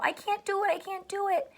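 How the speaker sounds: a mock whiny child. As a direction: imitate a child giving up. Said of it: "if you say to a parent".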